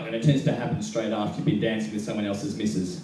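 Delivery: normal talking voice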